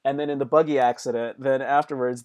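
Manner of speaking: ordinary talk, nothing marked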